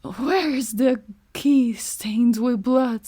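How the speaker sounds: slow, hoarse, whispery menace; deep hoarse demand. Acted. Bluebeard demanding the bloodstained key in a slow hoarse whisper. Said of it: "in a deep, hoarse voice demanded".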